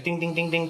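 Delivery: high, light run of dings; imitated toy dinging. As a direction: mimic a tinkly toy keyboard in a high voice. Imitates a keyboard toy's dinging.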